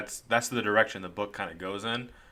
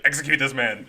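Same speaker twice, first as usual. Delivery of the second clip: laughing command; 4 semitones higher, 5 dB louder than usual. Retold or imitated. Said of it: "immediately he's like".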